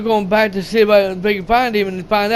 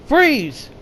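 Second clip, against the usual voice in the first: higher-pitched mock command to halt; retold command; 6 semitones higher than usual. Man ordering a runner to stop, retold high-pitched command. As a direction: call it out high, without extra volume.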